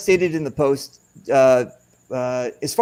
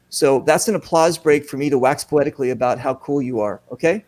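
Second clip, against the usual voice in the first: ordinary talk, nothing marked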